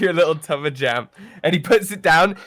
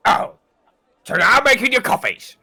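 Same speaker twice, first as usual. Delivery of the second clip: louder and higher, huffy outrage; indignant chef; 6 semitones higher, 8 dB louder than usual. Acted. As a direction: play the offended cook throwing up his hands. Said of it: "Rex goes".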